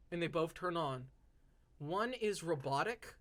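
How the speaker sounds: ordinary delivery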